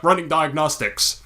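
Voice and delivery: lightly voiced slow robot; a hint of a robot reporting system status; measured, mildly mechanical robot imitation. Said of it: "we were talking to this robot".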